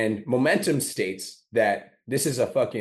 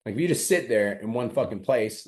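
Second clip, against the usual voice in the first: usual speaking voice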